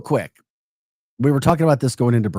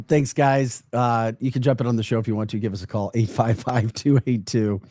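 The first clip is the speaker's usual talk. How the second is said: ordinary delivery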